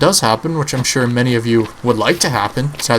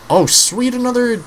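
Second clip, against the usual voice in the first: excited; 13 semitones higher than usual. Real, not acted. Finds another med kit.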